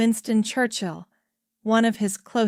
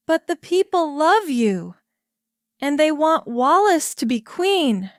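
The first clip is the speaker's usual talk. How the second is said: pleading; 6 semitones higher, 4 dB louder than usual. A narrator's reading. Churchill pleading with the king.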